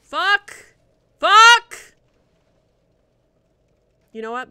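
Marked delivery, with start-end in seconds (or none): 0.0-2.0 high, loud angry curses; real furious yelling; furious yells. Real, not acted. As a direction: bellow the curse in rage. Dying in game.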